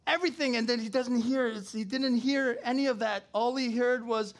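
loud, high preacher retelling Elijah; preaching at full force. Acted. Preacher to congregation, loud and high fervour. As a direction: build it up, loud and high, like a storm.

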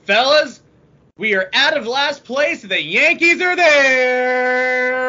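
high, loud, drawn-out gloat; gloating announcer. Acted. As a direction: crow it slowly like a victory call. Gloating Yankees in last place.

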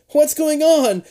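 high, urgent demand. A narrator's reading. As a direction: demand an answer. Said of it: "you demand".

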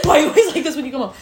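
rushed mock mom, giggling; quick laughing imitation; fast laughing quote. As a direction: rattle off the mom's line, laughing. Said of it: "his mom is like".

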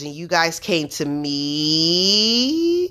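very slow, drawn-out, unsettling voice; eerie mother voice. Retold or imitated. Mother, woken by the family, speaking eerily slow. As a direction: stretch every word like something else is speaking through her. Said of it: "and she says".

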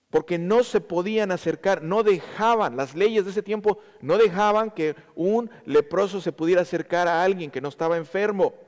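ordinary talk, nothing marked

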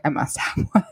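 breathy longing. Retold or imitated. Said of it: "instantly I was like".

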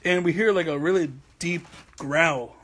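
ordinary talk, nothing marked

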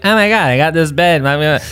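mock cornball. Podcaster mocking people who post branded stuff, cornball gushing. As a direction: imitate a corny sponsored post. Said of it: "not saying this in a cornball way".

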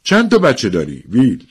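acted formal inquiry; formal question. A narrator's reading. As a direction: ask it as an official formality. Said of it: "خیلی رسمی پرسید".

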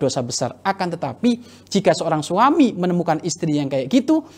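ordinary talk, nothing marked

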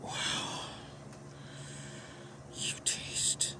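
breathy close whisper, slow. Acted. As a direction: hush it right into the mic. Intimate scene, whispered praise.

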